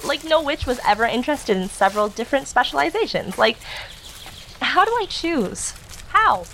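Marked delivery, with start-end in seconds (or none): 4.6-6.4 high, drawn-out plea of indecision; exasperated whine. Acted. Overwhelmed witch whining.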